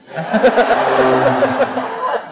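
loud high laugh; big laugh. Real, not acted. Laughing at the Brian Saul idea.

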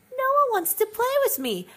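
mock a sulky kid begging for company; whiny little sister. Mocking five-year-old sister.

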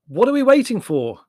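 loud and high, exasperated; impatient brother in a hurry; irritable impatience. A narrator's reading. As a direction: push them to hurry up. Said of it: "he looked round irritably".

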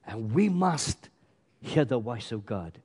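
drawn-out, weighty MUST; emphatic. Real, not acted. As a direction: press slowly and firmly on "we must". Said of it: "Why do I say it so emphatically".

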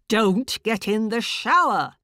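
loud, high repeated bark; barked order again. Acted. Inner voice repeats its command, loud bark. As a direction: insist with force. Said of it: "this awful bullying voice".

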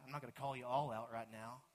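ordinary delivery

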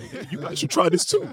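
high, cheery voice of a carefree guy; a cheerful stay-at-home dad urging his friends on, sounding upbeat; happy-go-lucky guy. Retold or imitated. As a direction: sell it like an upbeat, easygoing dad. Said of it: "He's so happy-go-lucky".

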